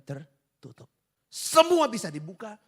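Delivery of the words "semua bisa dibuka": loud, high, slowed burst; emphatic proclamation. Real, not acted. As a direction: proclaim it forcefully to the congregation. Preacher bursts out with the lesson.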